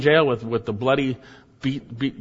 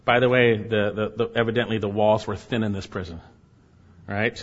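ordinary talk

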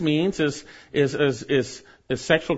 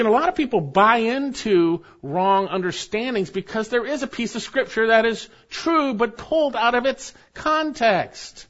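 normal talking voice

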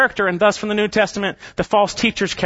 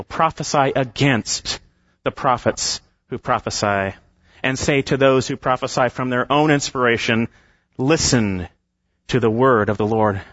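scripture reading; 6 semitones lower than usual. Acted.

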